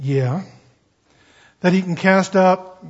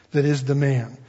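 ordinary talk

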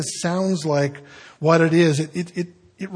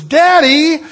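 high, loud frightened cry of Daddy; child's cry; 10 semitones higher, 10 dB louder than usual. Retold or imitated. Daughter crying out to her father from the water. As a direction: shout it like a scared little girl surfacing. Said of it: "for a minute she was".

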